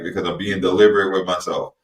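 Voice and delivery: usual speaking voice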